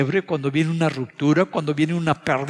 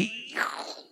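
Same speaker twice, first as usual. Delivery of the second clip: pained exclamation; 9 semitones higher, 11 dB quieter than usual. Retold or imitated. Retelling hand slammed in car door.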